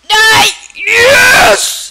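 sudden loud yell of Die; battle yell. Real, not acted. Gamer yelling at an enemy, loud yell.

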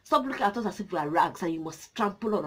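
usual speaking voice

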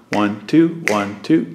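steady two-beat count, One, two, one, two; teacher counting a duple beat. Shown as a demonstration. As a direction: count the pulse in twos, evenly. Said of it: "Duple meaning two".

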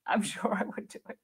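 a soft, airless laugh through the words; laughing under breath. Real, not acted. Streamer to viewers, owning she'd book cheap, laughing softly. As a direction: giggle it out quietly, half to yourself. Repeats own line, then laughs.

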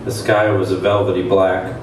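ordinary talk

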